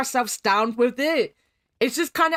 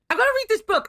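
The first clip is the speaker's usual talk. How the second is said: pitched up, eager about the book; eager exclamation; 10 semitones higher, much faster than usual. Real, not acted. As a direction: announce it with excitement. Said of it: "pages and pages of lore".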